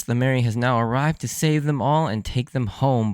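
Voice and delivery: normal talking voice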